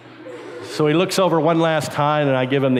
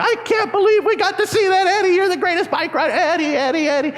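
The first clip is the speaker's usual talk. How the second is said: high-pitched gushing cheer; hammed-up elation. Kid hugging the guy next to him, overexcited high cheering. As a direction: squeal it like an overjoyed kid fan.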